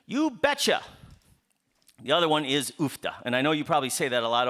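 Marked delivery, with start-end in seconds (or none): 0.0-0.8 high-pitched Wisconsin-Minnesota lilt; Midwestern expression. Shown as a demonstration. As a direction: show off the favorite Midwestern saying. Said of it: "That's one of our favorite expressions".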